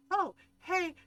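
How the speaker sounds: high, loud startled Oh, hey; caught creep. Retold or imitated. Creep caught by the mom, startled and loud. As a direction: hands up, caught red-handed and startled.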